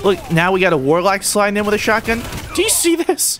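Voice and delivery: high, indignant complaint; incredulous gamer whine; exasperated. Real, not acted. Complains at another shotgun user.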